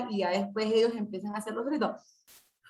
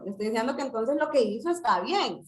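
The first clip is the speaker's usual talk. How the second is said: usual speaking voice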